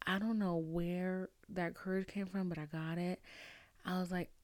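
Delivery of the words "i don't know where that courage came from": ordinary talk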